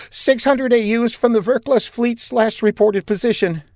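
high-voiced, jittery officer reporting; lieutenant reporting to captain, high and nervous; nervous young lieutenant. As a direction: report while still shaken.

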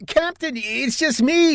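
high, slow frightened voice of a skinny lieutenant; lieutenant startled and scared, high and slow; frantic yell. Acted. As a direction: plead in terror with a gun at his chest. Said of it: "yelled a frantic voice".

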